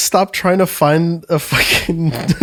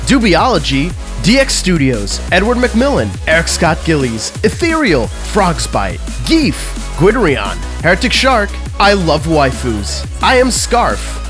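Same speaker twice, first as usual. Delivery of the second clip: upbeat podcast outro; outro read; 2 semitones lower, slower than usual. Acted. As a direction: read the credits brightly. Patron credits outro read.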